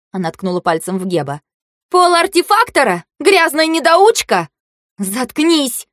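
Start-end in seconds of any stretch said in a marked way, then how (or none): 1.9-4.5 high, indignant questioning insult; scornful outburst. A narrator's reading. Girl insulting the artificer, high and scornful. 5.0-5.9 higher, flaring retort. A narrator's reading. Fei-Fei snapping back at her rival, flaring up. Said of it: "вспылила Фэй-Фэй".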